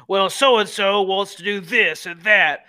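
slow, smug political ad read; mock a campaign attack ad. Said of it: "commercials where, you know, they blame".

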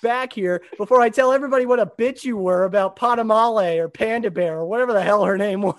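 hammed-up exasperated boss; mock furious rant. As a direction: chew him out in one long rolling rant. Acted furious Emperor scolding Vader.

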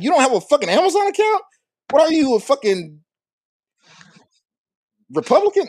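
high, incredulous mock outrage; mock-shocked high outburst at a friend. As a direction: act shocked someone lacks Amazon. Mock outraged retold rant.

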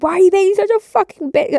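high, loud mock shriek at her sister; angry yell. Retold sister yelling insult.

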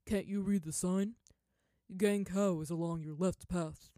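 loud, irritated merchant snapping; irritable. A narrator's reading. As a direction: brush her off with annoyance. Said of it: "he said irritably".

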